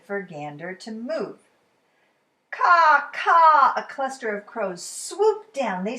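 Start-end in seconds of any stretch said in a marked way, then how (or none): 2.5-3.7 loud, high crow calls; crow caws. Acted. Narrator voicing the crows, loud cawing. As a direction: caw out like a swooping crow.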